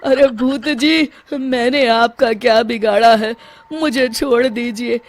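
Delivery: pleading in fear. Acted. Sarpanch begging the ghost to spare him, tearful. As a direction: beg for mercy with a breaking voice.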